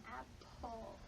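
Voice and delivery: soft modeled word. Shown as a demonstration. Therapist softly models apple for a toddler to copy. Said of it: "Try saying it again".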